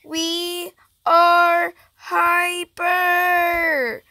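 very slow, each word stretched out; slow-motion declaration; drawn-out announcement. Pretend. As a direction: hold every word long and even.

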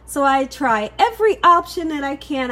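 ordinary talk, nothing marked

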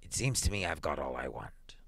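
low, hushed reflection; slow, musing. A narrator's reading. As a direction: muse softly, almost under the breath. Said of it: "he said slowly".

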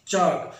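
چاک with no L sound; long vowel in چاک straight to the K; correct pronunciation. Shown as a demonstration. Teacher repeats for learners, silent L in چاک. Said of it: "وہ یہ ہونی چاہیے".

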